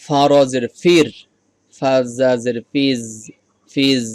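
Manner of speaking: slow, loud ফিজ with a cricket-like buzz; buzzing za sound. Shown as a demonstration. Teacher drills students on buzzing za, slow and loud. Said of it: "ঝিঝি পোকার মতো একটা sound হবে".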